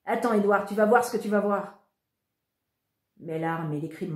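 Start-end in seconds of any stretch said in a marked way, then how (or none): 0.1-1.8 exasperated outburst. Acted. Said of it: "s'est exclamé mon frère".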